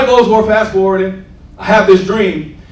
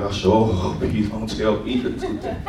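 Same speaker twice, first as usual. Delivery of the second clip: low, softer mock reluctance; lightly voiced congregant; 8 semitones lower, 8 dB quieter than usual. Preacher voicing his congregation's private dread, lightly mocking congregant. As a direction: lightly mimic a worried listener under the breath. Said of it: "Some of y'all sitting there like".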